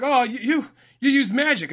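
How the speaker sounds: put-on a startled gasp at the kid; surprised. Retelling hunchback talking to kid.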